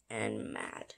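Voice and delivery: dropped very low and soft; grumpy low voice. A narrator's reading. Narrator ends the cats' list of woes, grumpily low. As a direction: sink to a low grumble to end the list.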